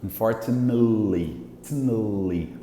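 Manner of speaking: low, soft "Unfortunately", drawing out tn-li; reduced ending. Shown as a demonstration. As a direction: linger on the nasal n before "li" in "Unfortunately". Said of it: "como se não tivesse isso aqui".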